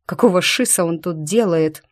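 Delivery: ordinary delivery, nothing marked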